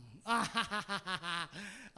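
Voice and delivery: rolling open laugh; hearty laugh. Real, not acted. Preacher after reading the verse, hearty laugh. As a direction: burst into a long ha-ha-ha.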